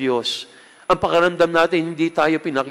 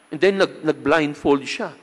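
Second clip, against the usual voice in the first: normal talking voice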